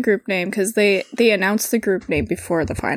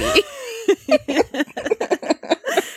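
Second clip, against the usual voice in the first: high laugh; 14 semitones higher than usual. Real, not acted. Laughing at poor baby.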